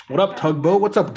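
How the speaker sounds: normal talking voice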